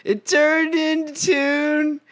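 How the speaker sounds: put-on high, slow lover-boy voice; drawn-out dreamy lover boy, breaking into laughter; naive Orpheus. Retelling Orpheus's answer in a high, slow mock voice. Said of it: "And Orpheus is like".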